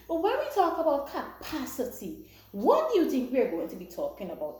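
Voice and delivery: normal talking voice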